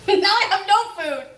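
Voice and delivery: a high, laughing wail of despair; rueful laugh at the ruined dinner; laughing in dismay. Real, not acted. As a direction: laugh it off after burning the stew. Stew just burnt.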